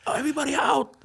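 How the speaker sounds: high, loud order through laughter; laughing shout. Retold or imitated. Guard ordering everyone out, high laughing shout. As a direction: bark everybody out while cracking up. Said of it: "And, and, like".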